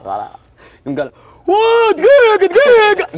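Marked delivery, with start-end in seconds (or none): 1.5-3.2 high, slow, drawn-out mocking voice; imitated laugh voice. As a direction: stretch each word up high and slow. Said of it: "نبرة الصوت حقه ترى".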